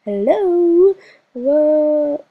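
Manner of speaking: ordinary delivery, nothing marked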